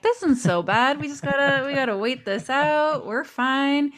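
lightly acted, all is fine; carefree reassurance. Voicing own early carefree self.

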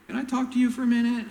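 high, quick, eager asking; a mother angling for a private word. Retold or imitated. Mother of James and John asking Jesus, high and eager. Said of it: "Missus Zebedee comes to Jesus".

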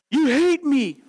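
high-pitched mock wail of a disciplined kid; protesting child. Disciplined child accusing a parent, protesting in a high voice.

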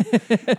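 sudden loud high laugh; burst of laughter. Real, not acted. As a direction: crack up laughing. Big laugh at his own joke.

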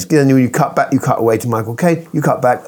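ordinary talk

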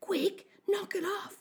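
high, slow insistent urging; urgent egging-on. A narrator's reading. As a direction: push them to grab it now. Bully urges boy to steal.

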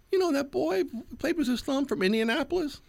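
very high Southern drawl; imitated high-pitched Southern accent. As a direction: mimic a Southern lady, voice way up high. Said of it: "her southern, like, drawl kind of accent".

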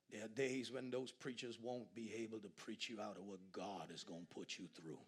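ordinary talk, nothing marked

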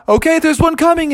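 quick, high and anxious; hurried, high-pitched readiness; nervous bracing. A narrator's reading. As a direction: brace for the next tree. Bear readies for next tree.

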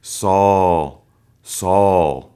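lightly voiced heavenly calling. Shown as a demonstration. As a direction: call the name like a voice from heaven. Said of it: "It can sound so, like, heavenly".

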